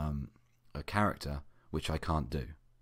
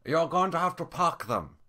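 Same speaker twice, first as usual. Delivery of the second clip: put-on Korean accent; 11 semitones higher than usual. Manager voiced in Korean accent.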